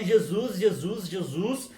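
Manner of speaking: ordinary talk, nothing marked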